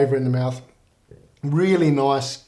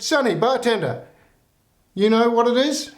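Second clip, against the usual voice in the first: put-on higher old granny asking sweetly; pitched-up old lady teasing the barman; 8 semitones higher than usual. Old lady addresses the bartender.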